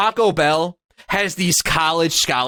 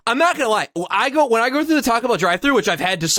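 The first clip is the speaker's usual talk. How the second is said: usual speaking voice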